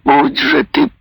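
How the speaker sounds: high, slow rasping curse; hoarse, dying-rattle curse; death rattle. A narrator's reading. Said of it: "голосом, походившим на предсмертное хрипение".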